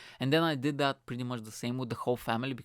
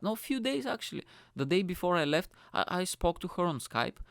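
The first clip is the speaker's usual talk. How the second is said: ordinary talk, nothing marked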